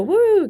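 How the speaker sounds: high mock cheer from the crowd; crowd woo. Singer mimics a pub crowd answering her, mock cheer. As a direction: imitate an audience whooping.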